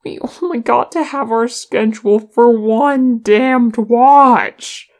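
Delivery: loud, high wailing complaint; anguished, exasperated outcry; moaned. A narrator's reading. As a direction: moan it out, swelling on the last word. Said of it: "moaned Nali".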